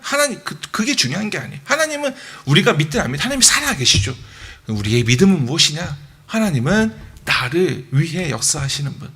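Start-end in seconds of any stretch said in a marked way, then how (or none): none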